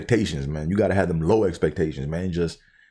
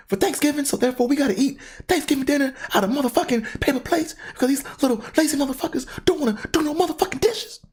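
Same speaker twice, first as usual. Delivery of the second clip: high, fast angry mother voice; imitating cussing mom. Mom cussing to her son about the lazy kids, high. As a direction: rant it high like a furious mom. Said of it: "She was like".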